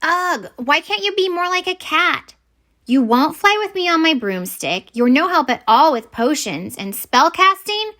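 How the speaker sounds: high, exasperated scolding of her pet; exasperated girl scolding her wombat; frustrated scolding. Acted. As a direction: vent your frustration at the wombat, pitch up.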